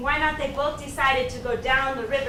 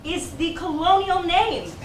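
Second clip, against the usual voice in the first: ordinary talk, nothing marked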